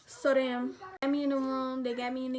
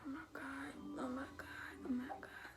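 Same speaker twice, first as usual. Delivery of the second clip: breathless whispered oh my God, much softer; scared whisper; 16 dB quieter than usual. Retold or imitated. In the elevator holding her stomach, frightened whisper. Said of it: "I'm just holding my stomach like".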